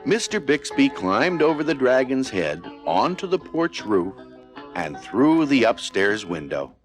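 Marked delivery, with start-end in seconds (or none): none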